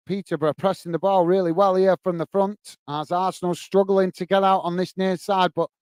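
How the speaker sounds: steady running commentary on a Peterborough press; live play-by-play. Acted. Streamer calling the match for his live chat, easy play-by-play. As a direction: call the foul and free kick as they come.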